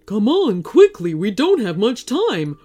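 horse character's pressing warning; storybook urgency, starting loud; urgent warning. A narrator's reading. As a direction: warn them to hurry, punching the first words. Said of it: "Elmo warned".

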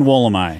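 "Wollemi" with a final "eye" sound; Australian pronunciation. Shown as a demonstration. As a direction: settle on "Wollemi" ending in "my". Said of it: "officially from here on out".

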